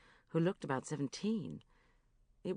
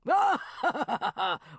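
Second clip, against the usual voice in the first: booming laugh; 9 semitones higher, 7 dB louder than usual. Acted.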